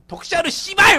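high, loud mock heckle; hammed-up terrace shout; mock abusive fan. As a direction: yell abuse at a player like an angry fan. Imitates fan cursing a player directly.

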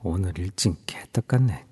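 low, soft annoyed grumble; muttering. Acted. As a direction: grumble it under your breath. Annoyed muttering under breath.

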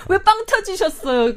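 laughing while asking; giggly high question. Real, not acted.

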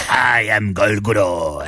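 slow, low monster boom. Pretend. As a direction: announce yourself as a hulking monster. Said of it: "Sounds like a monster".

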